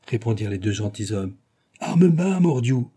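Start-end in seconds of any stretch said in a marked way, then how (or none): 1.8-2.9 high, loud captain's command; urgent military order, raised voice; shouted order. A narrator's reading. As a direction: cry out to halt the soldiers. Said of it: "s'écria le capitaine aux soldats".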